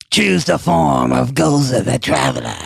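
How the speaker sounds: low, slow booming deity; deep Gozer voice. Retold or imitated. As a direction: intone it like an ancient god. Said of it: "Gozer shows up".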